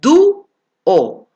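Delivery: "Du-o" in two beats, du loud and high, pause, o; separated syllables. Shown as a demonstration. Teacher separating the hiatus for students, in separated syllables. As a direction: punch du and detach the o in "Du-o". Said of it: "Lo tildo y lo separo".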